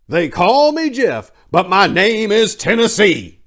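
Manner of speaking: very high, loud, slow proud proclamation; grand declaration. Real, not acted. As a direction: announce your true name like a battle cry. Dramatic closing declaration of identity.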